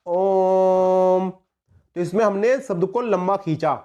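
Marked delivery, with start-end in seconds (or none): 0.1-1.3 ओम held long on one steady pitch; drawn-out Om. Shown as a demonstration. Teacher showing students the prolonged vowel in ओम, held long. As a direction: stretch ओम out like a chant. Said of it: "इसको हम किस प्रकार ध्वनित करेंगे".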